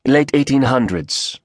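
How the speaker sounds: quick, clipped Korean speaker; Korean accent. Acted. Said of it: "a rapid-fire Korean voice answered".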